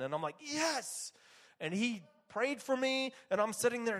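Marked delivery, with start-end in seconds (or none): 0.4-1.1 high, drawn-out yes; retold excited yes; eager yes. Eager answer in retold prayer scene.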